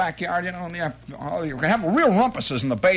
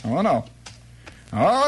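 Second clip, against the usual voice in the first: ordinary talk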